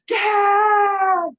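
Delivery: high, loud yell for Dad; long piercing shout across the house; screaming. Retold or imitated. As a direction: scream for your father in a panic. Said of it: "He's screaming".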